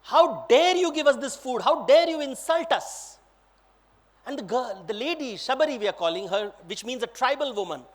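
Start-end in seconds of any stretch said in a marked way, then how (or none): 0.0-3.2 affronted, raised-voice protest; very upset. Acted. Lakshman the prince rebuking the lady, very upset. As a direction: scold as an insulted prince. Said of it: "He's very upset and he's saying".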